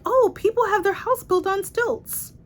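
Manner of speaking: high, breezy mock voice for the author's casual line. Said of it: "She's just like".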